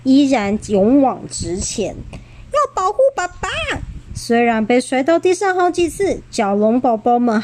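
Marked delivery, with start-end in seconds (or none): 2.5-3.5 put-on high little child's voice; tiny determined baby voice; baby dinosaur voice. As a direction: squeak it out like a brave baby dino.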